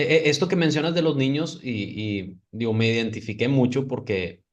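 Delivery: ordinary talk, nothing marked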